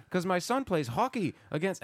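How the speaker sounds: high imitation Canadian accent; thick Canadian accent. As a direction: explain in a put-on, high Canadian lilt. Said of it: "this guy has a real thick accent".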